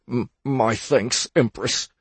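shivering stammer through clenched teeth. Acted. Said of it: "he stammered through clenched teeth".

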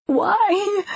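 high, drawn-out wail; a tearful, pleading cry; anguished high-pitched sob; sobbing. A narrator's reading. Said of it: "sobbing against him".

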